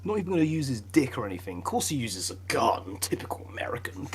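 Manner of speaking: muttered under the breath; whispered aside. Real, not acted. A muttered jab at his American friend.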